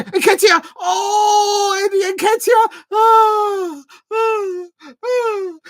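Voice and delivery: high, loud cries at a near miss; excited scream. Real, not acted. Fan screaming at Nketiah's near miss. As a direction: scream as the shot nearly goes in.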